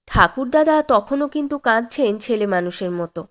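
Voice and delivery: ordinary delivery, nothing marked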